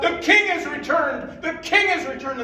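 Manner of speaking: high, loud joyful shout; sentries yelling. Acted. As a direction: cry it out from the walls. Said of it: "they yelled out".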